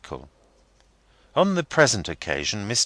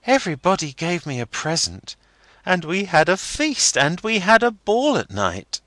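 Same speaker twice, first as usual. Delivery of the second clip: put-on young girl Rosa; 11 semitones higher, 5 dB louder than usual. A narrator's reading. Rosa voiced in story.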